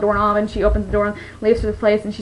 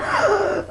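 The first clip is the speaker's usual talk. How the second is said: gasp; 10 semitones higher, 3 dB quieter than usual. Retold or imitated. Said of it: "she's like".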